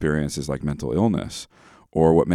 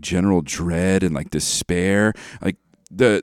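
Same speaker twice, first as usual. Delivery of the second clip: normal talking voice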